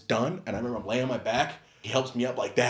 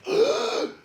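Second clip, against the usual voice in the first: gasp; 5 dB louder than usual. Retold or imitated. Wind knocked out.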